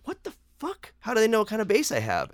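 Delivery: the floor just dropped out from under you; stunned disbelief. Retold or imitated. Retelling shock at the border officer.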